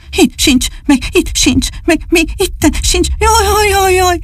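high, panting little dog whimpering; sighing little dog. Acted. Csupi the dog frets over what he lost, high and sighing. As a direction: sigh and pant like a worried puppy. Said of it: "sóhajtotta, lihegte".

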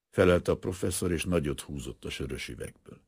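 ordinary delivery, nothing marked

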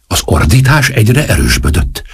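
ordinary delivery, nothing marked

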